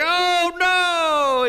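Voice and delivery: hammed-up cheerful old man. Old man answers evangelist.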